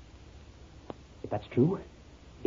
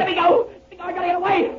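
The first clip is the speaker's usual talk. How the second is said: much higher, desperate to break free; panicked pleading; 5 dB louder, faster than usual. Acted. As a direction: pull away in blind terror. Man struggles to flee.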